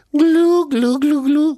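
high cartoon glug-glug; imitated gulping. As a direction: imitate wine bubbling down the throat. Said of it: "A glu-glu azt jelentette, hogy az egri bikavér bugyborékolva szaladt le".

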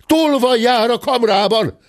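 high, sustained warning call; alarmed cry. A narrator's reading. Someone warning the inn of a thief in the pantry, alarmed cry. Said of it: "hallatszott ebben a percben egy kiáltás".

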